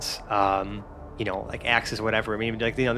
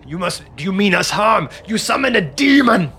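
giant raises a booming, higher voice in alarm; alarmed giant shout; 8 semitones higher, 6 dB louder than usual. Acted. Giant accuses the party, loud alarmed shout.

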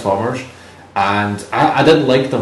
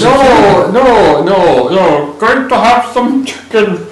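high, loud mock chef voice; hammed-up Muppet chef gibberish; Swedish Chef voice; 8 semitones higher, 4 dB louder, much slower than usual. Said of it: "Oh my God, stop that".

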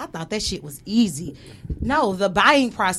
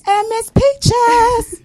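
squeaky high put-on voice; high character voice; 14 semitones higher, 7 dB louder, much slower than usual. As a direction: pitch way up into a cartoon voice. Podcast intro in put-on voice.

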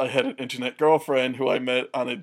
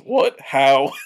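pitched-up astonishment edged with laughter; retold incredulity; 7 dB louder than usual. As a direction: blurt it, voicing her disbelief. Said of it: "speaking in my voice".

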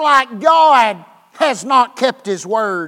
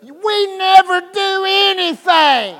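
put-on whiny child; 7 semitones higher than usual. Child voiced complaining.

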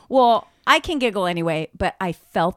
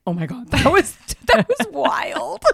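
retold shaken reaction; 5 semitones higher than usual. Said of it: "we're kinda, like, shaking".

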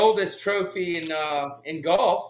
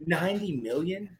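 softer, whispered question; hushed disbelief; 8 dB quieter than usual. Real, not acted. Co-host echoing a rumoured golf payout, whispered disbelief. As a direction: whisper it, taken aback by the sum. Stunned echo of the sum.

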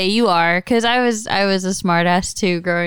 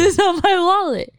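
much higher, put-on cartoon voice; Patrick; 9 semitones higher than usual. Quoting a cartoon character denying his wallet, goofy put-on voice. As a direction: imitate a stubborn cartoon denial. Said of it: "it's like Patrick and SpongeBob".

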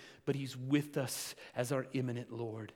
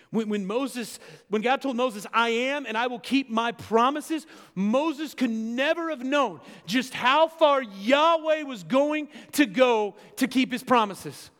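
rising, much louder and higher preacher's cry; preaching at full force; 8 semitones higher, 8 dB louder than usual. Acted. Preacher driving home God's promise, loud and high. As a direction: build the sermon to a ringing peak.

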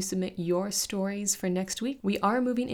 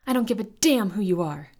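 defiant shout; 6 dB louder than usual. Acted. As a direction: shout him down, scared but fierce. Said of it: "Talay shouted".

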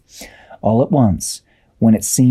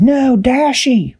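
high, slow, stretched cry of alarm; Tweak's cry; much slower than usual. A narrator's reading. Tweak seeing Dashi fall, high alarmed cry. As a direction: call out in shock, drawn out high. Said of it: "Tweak said".

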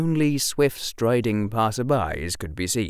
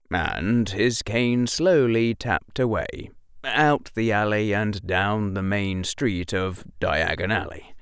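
usual speaking voice

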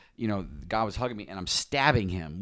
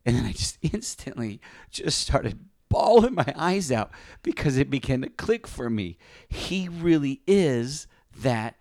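high, emotion-filled voice with halting pauses; choked up; 5 semitones higher than usual. Real, not acted. Man recalling his realization of God's goodness, choked up. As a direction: push the words out through welling emotion, slowing down. Moved recalling when he bawled.

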